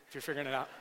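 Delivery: ordinary talk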